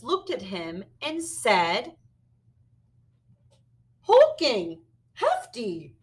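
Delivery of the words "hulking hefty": lightly voiced big dinosaur, loud; Allosaurus voice. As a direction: boom out the words playfully. Allosaurus speaks.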